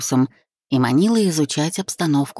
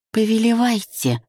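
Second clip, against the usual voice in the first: higher-pitched teasing mockery; mocking; 7 semitones higher than usual. A narrator's reading. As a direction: mimic obedience mockingly, pitch lifted. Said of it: "передразнила я". (насмешливо передразнивая)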